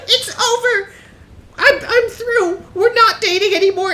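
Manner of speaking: very high, loud hammed-up squeal; kitten girlfriend voice. Streamer retelling a meme, voicing the kitten breaking up, hammed-up high squeal. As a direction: play the dumped girlfriend wailing, way up high. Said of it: "She's like".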